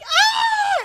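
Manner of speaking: put-on high startled child scream; kid scream.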